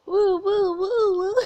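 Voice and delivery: high, loud repeated cooing; high-pitched little bot calling out; cute robot noises. Acted. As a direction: coo like a tiny excited robot. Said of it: "the sounds he always makes".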